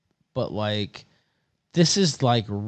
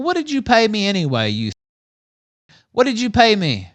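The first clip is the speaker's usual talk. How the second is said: ordinary talk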